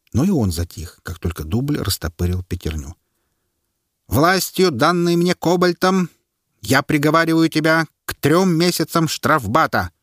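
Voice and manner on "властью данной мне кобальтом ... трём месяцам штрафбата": high, solemn sentencing voice; herald's verdict. A narrator's reading. Herald sentences the butcher before the crowd. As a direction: proclaim the sentence with full authority.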